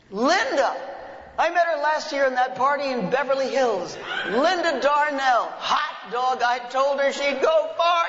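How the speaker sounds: high and loud, giggling through it; laughing, delighted. Acted. Father tells his son about the actress, laughing loudly. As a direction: crow with delight between laughs.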